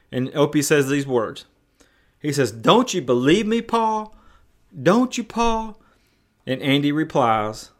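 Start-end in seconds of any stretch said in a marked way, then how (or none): 2.5-5.8 put-on higher, pleading child's question; near-tearful plea. Son asking his father to believe him, almost tearful. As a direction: imitate a boy begging to be believed. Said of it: "almost to the point of tears".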